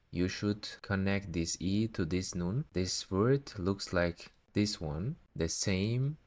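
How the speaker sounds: usual speaking voice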